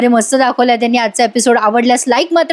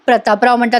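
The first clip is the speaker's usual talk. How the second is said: ordinary delivery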